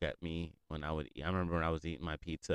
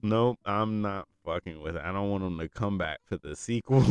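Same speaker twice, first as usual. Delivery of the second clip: normal talking voice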